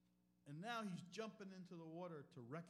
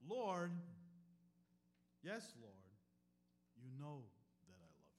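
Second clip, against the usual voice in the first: earnest plea; 6 semitones lower, 5 dB louder than usual. Acted. Peter's pleading answer.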